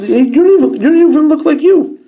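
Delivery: high, loud, mock outrage at the toy; hammed-up high-pitched disbelief; mock shocked voice. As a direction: squeal it like a jilted fan. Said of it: "I'm like".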